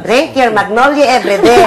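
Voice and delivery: joking celebrity ad impression; loud ad-line mimicry, played for laughs; Manny Pacquiao. Director joking to actress with an ad line, Manny Pacquiao impression. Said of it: "Manny Pacquiao".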